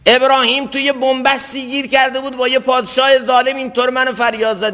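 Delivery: high, sustained sermon delivery; impassioned preaching. Real, not acted. Preacher recounting Abraham's cry to God, impassioned.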